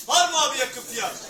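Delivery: loud calling for bids; auctioneer. Acted. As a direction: call out for a bid.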